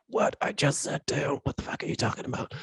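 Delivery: soft, strained mock protest under the breath; incredulous protest. Man arguing with his intuition, hushed disbelief.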